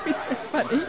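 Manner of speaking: soft, laughing. Real, not acted. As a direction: giggle through it. Laughing at the kids.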